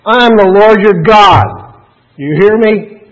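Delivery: loud, high, drawn-out mock thunder; exaggerated booming scolding, slow and forceful; misread harsh command. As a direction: bellow it like an overbearing, scolding God. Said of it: "emphasize words wrongly ... I've heard people read".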